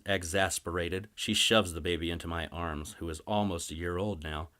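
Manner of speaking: ordinary delivery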